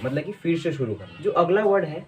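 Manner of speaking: ordinary delivery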